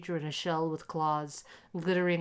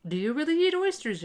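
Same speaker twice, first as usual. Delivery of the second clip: much higher, louder amused question; teasing gentleman; 10 semitones higher, 6 dB louder than usual. A narrator's reading. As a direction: poke fun at the hungry boy. Second gentleman teases the boy.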